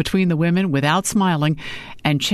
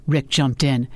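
usual speaking voice

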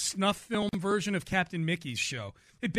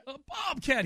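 drill sergeant voice; 6 semitones higher than usual. Retold or imitated. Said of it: "He sounds like a drill sergeant".

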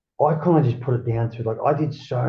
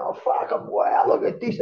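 re-enacted wonder, pitched much higher; retold amazement; 12 semitones higher than usual. Freed prisoner recalling his first walk, amazed. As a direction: marvel at everything around you.

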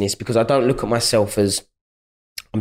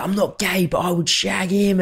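put-on high, leering drunk fan; mock the pushy drunk's voice; drunk lad; 8 semitones higher than usual. Drunk fan tells the girlfriend this, high and leering. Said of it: "he's like".

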